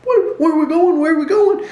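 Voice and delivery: high, loud panicky squeal; alarmed passenger. Retold or imitated. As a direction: shriek it like a frightened passenger. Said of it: "and she goes".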